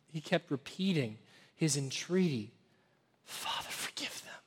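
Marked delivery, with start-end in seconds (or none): 3.2-4.4 breathy whisper of a dying man; hushed plea from the cross; whispered prayer. Acted. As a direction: whisper it, pleading. Jesus's prayer voiced on cross.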